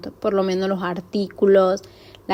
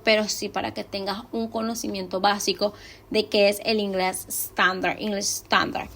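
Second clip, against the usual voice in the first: ordinary talk, nothing marked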